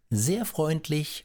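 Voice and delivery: high, slow, sugary thanks; "sehr freundlich" lifted high and drawn out; sly politeness. A narrator's reading. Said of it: "Er verneigte sich höflich und sagte".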